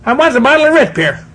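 put-on high, little kid's plea; little boy asking. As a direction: ask like a small boy wanting a treat. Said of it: "I go to my grandma and say".

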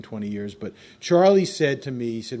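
ordinary delivery

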